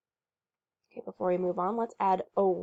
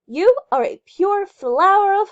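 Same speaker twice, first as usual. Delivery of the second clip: louder, higher adoring praise; gushing praise; 11 semitones higher, 10 dB louder than usual. Acted. Leroy gushing compliments to his horse, loud and high. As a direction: gush over the horse.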